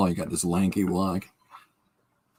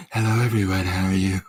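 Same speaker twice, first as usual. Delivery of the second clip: lightly put-on youthful greeting; a hint of a mock younger-self voice; young Linda; 2 semitones lower than usual. Streamer imagining Linda's voice when she was younger, mock youthful voice. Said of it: "Linda's voice when she was younger was all".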